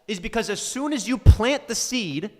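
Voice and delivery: real frustrated tirade; exasperated rant. Real, not acted. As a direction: lay into them, building to a loud finish. Scolding viewers in a rant.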